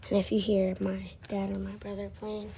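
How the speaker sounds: loud unvoiced whisper; stage whisper, pushed air. Whispered aside about family.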